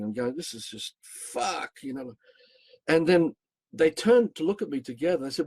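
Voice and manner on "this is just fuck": retold curse, drawn out slowly; exasperated cursing. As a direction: stretch the swear word long and high, without shouting. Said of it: "I'm cursing".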